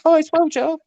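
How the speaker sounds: put-on teasing a friend with a posh English accent; mock posh accent. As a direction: mimic his supposed real posh English voice. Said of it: "we really talk like".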